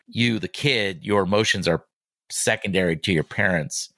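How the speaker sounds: normal talking voice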